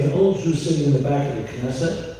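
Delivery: ordinary talk, nothing marked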